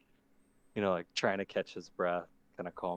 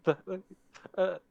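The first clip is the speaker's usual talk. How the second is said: put-on much higher, panicked young creature; frightened kid voice; 7 semitones higher, 8 dB louder than usual. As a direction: stammer in terror.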